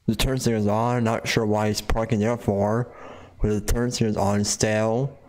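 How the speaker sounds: normal talking voice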